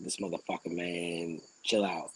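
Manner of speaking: low, soft exasperated mutter; muttered under breath. Retold or imitated. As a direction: grumble it to yourself. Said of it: "I'm like".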